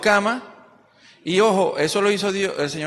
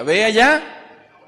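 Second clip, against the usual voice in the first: slow, loud, raised command as God; 5 semitones higher, 7 dB louder, much slower than usual. Acted. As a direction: boom out the order like God himself.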